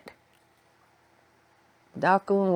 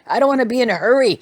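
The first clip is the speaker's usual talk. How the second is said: playful grandma; 8 semitones higher, 9 dB louder, much faster than usual. Retold or imitated. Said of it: "she said".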